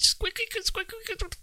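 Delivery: high squeaky record-scratch noises; imitated DJ scratch. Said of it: "not vinyl scratch".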